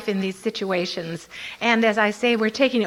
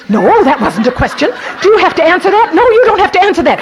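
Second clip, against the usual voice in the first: excited inner argument; 7 semitones higher, 10 dB louder, faster than usual. Retold or imitated. Inner dialogue argued.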